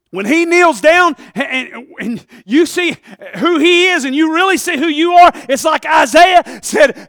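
loud shouted preaching that climbs to a whoop; preaching at full force. Real, not acted.

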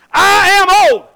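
loud, high, indignant shout; bad attitude. Preacher translating Zechariah's reply to the angel, bad-attitude shout. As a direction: throw it back as a defiant bellow. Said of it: "with a bad attitude".

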